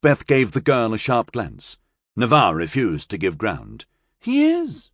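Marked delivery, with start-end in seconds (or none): 4.2-4.9 insisting. A narrator's reading. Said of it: "she insisted".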